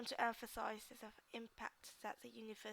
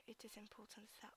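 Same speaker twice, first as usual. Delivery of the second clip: whisper; 10 dB quieter than usual. Shown as a demonstration. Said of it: "practising the phrase under breath".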